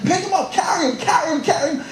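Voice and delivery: mock urgent yelling; urgent orders. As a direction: yell at the team to carry him.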